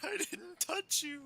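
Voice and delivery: squeaky innocent protest. Pretend. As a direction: drag it out, faux innocent. Defending after distracting driver.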